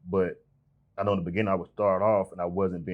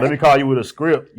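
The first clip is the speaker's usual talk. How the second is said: loud, higher mock salesman voice; scripted caller; 7 semitones higher, 11 dB louder than usual. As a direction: read from a script like a robot. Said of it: "You sound like a robot".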